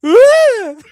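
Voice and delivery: high shrieking woo then laughing; mock cheer. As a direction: squeal it out sarcastically.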